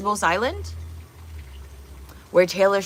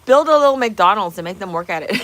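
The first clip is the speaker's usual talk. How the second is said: amused; 3 semitones higher, 9 dB louder than usual. Real, not acted. Joking, breaks into laughter.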